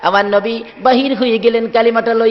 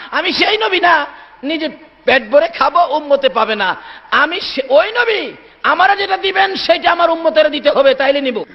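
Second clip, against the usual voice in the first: high, insistent declaration; emphatic refusal; 6 semitones higher than usual. Acted. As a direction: refuse firmly with rising fervour. Prophet's acted firm refusal to God. (জোর গলায় দৃঢ় অস্বীকার)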